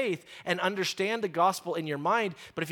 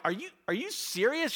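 incredulous; 7 semitones higher than usual. Retold or imitated. Acting out disbelief at waitress.

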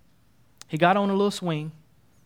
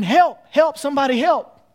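loud, higher-pitched call for rescue; screamed plea for help; 7 semitones higher, 7 dB louder than usual. Acted. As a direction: call out urgently for anyone to come. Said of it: "[name] started screaming".

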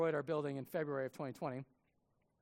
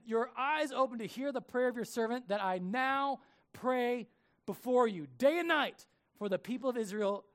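louder, higher solemn prayer; earnest scripture read aloud; 5 semitones higher, 6 dB louder than usual. Acted. Reads Nehemiah's prayer aloud.

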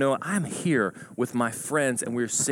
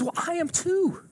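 very high, drawn-out delight; giddy, high-pitched agreement; thrilled agreement. Acted. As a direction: squeal with joy at the match. Joke: growing delight at match.

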